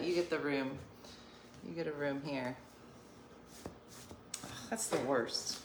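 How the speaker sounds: usual speaking voice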